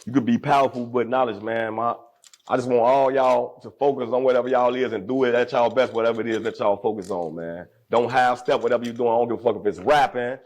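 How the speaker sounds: usual speaking voice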